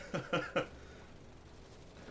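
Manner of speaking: breathy laugh at own joke; real giggling chuckle; amused chuckle. Real, not acted. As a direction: let the laugh spill out.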